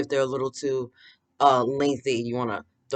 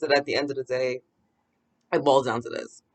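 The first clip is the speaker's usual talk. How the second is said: ordinary talk, nothing marked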